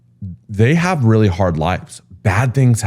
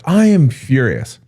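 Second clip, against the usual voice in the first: slow, higher-pitched mock fury; retold furious resident; 7 semitones higher, slower than usual. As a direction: act out an outraged caller, each word drawn out. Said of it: "is now screaming at the president".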